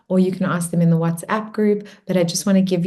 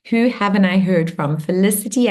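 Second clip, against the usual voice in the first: ordinary talk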